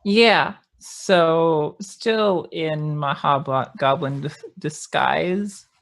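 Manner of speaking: ordinary talk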